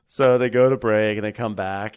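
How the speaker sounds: ordinary talk, nothing marked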